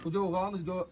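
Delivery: low, hushed listing; quiet. Real, not acted.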